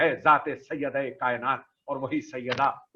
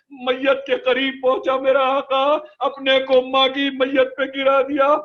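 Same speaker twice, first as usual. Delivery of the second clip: raised, loud, drawn-out grieving chant; full-force majlis lament; 10 semitones higher, 6 dB louder, slower than usual. Acted. Preacher to mourners on Husain's grief, loud slow lament. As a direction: wail the Karbala tragedy to the mourners.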